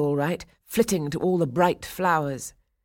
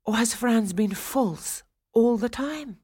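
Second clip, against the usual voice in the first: high, slow, despairing question; despairing wondering; 7 semitones higher than usual. A narrator's reading. Swanhilda doubting Franz to herself, slow and despairing. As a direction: draw it out in rising despair. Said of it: "she wondered in despair".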